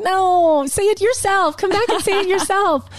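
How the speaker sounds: high, whiny talking back; mock protest. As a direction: argue back in a playful high whine. Retold arguing back at the nudging.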